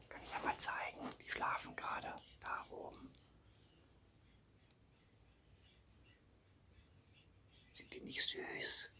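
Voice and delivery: soft whisper so the bats sleep. Real, not acted.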